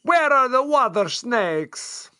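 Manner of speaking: slow, hammed-up Italian accent. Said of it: "That's my offensive Italian accent".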